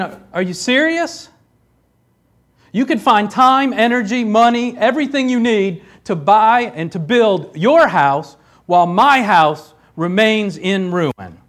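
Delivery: high, loud, incredulous sarcasm; sarcastic. Shown as a demonstration. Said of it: "you gotta read that with a little bit of sarcasm".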